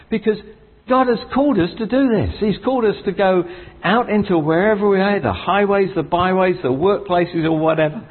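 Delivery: high, ringing preacher's proclamation; rising preaching fervour; fervent preaching. Acted. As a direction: lift the room with God's promises. Preaching climax, pitch raised high.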